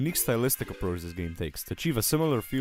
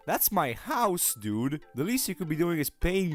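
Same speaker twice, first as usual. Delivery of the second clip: indignant; 7 semitones higher, slower than usual. Real, not acted. Indignant about shop in house.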